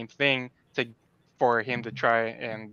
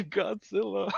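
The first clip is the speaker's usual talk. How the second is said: sheepish laughing answer; slower than usual. Retold or imitated. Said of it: "I'm like".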